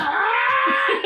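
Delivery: high, loud imitated shriek; mock pained scream. Retelling a man getting tased, mock scream. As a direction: scream like you've just been zapped.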